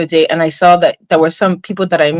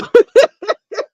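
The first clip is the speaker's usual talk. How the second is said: high-pitched burst of laughter; laughing; 13 semitones higher than usual. Real, not acted. Streamer tickled by her own cussing at YouTube, high laughing. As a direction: crack up in high giggles.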